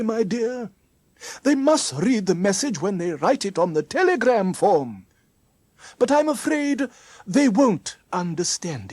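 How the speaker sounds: Mister Trim reassuring Mary. Acted. Mister Trim soothes Mary after laughing.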